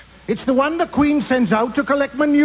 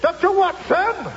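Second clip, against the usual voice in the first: megaphone call; 6 semitones higher, slower than usual. Acted. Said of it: "holding a megaphone".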